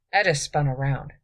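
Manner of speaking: ordinary delivery, nothing marked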